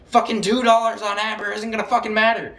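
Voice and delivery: high-pitched exasperated protest; exasperated arguing. Retold or imitated. Retold arguing back at grandma.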